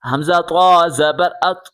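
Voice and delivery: "طا" with a heavy, full t; heavy emphatic t closing "ات"; thick t. Shown as a demonstration. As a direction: make "طا" and "ات" thick and rounded. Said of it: "اب یہ بڑی طا ہے".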